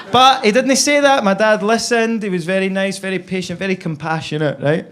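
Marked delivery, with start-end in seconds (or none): none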